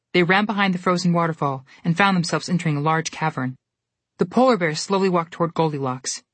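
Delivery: plain reading voice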